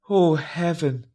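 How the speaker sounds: normal talking voice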